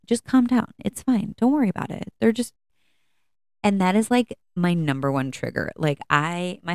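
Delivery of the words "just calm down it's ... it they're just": mock soothing advice. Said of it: "I've had people literally tell me".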